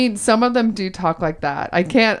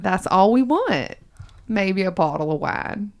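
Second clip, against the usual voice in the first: put-on Southern accent. Host jokes about Christmas wishes in a Southern accent. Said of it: "in my Southern accent".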